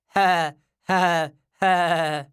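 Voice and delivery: slow, separate, mechanical "ha"s; strange laugh. A narrator's reading. Rosamond teases the great detective, strange laugh. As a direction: laugh oddly, one spaced syllable at a time. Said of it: "Rosamond has a strange laugh".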